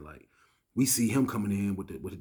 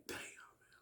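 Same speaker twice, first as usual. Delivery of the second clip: barely audible whispered dismay; stunned whisper; 22 dB quieter than usual. Retold or imitated. Inmates frozen waiting on the test, stunned whisper.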